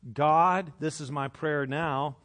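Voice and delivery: ordinary talk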